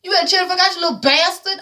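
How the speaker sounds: high, fast furious mock rant; angry record boss. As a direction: tear into him like an outraged exec. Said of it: "Tank was like".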